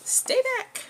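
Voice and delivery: very high, loud frightened shriek; high shrill cartoon stay back; panicked. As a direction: scream it like a scared heroine. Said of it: "She's all panicked".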